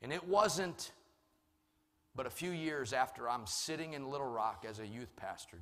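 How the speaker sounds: ordinary talk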